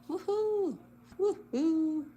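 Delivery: high, loud woohoo twice over; excited cheer. Real, not acted. Cheering a solved clue for the chat, excited. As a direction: cheer like you just nailed it.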